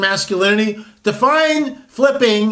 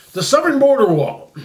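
topic announcement; 6 semitones higher than usual. Real, not acted.

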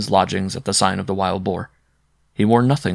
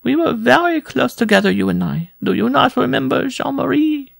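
very high, louder pleading woman; tearful pleading; 4 dB louder than usual. A narrator's reading. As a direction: plead high, as if near tears. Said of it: "a sob rising in her throat".

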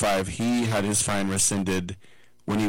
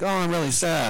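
higher, hammed-up whiny sadness; mock sad fans; 9 semitones higher than usual. Fans moping about losing Juszczyk, hammed-up and higher. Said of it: "And the fans are like".

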